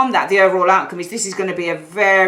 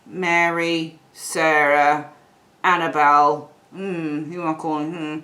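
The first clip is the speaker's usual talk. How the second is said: musing indecisively; 3 semitones lower, slower than usual. Acted. Other person choosing whom to call, musing indecisively. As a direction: mull over each name with lingering hums. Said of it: "And this person's like".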